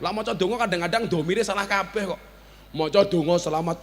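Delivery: ordinary talk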